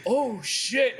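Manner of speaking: high, laughing oh shit; sudden realization. Retold or imitated. Son realizing he stayed up all night, high laughing dismay. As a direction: catch yourself realizing the time. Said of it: "And I said".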